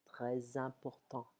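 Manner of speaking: très important said slowly, S of très linked as a Z; slow liaison with Z. Shown as a demonstration. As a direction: slowly join très to important through a Z sound. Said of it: "sounding like a Z".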